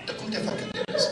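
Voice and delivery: mother voice. Retold or imitated. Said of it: "Zice că".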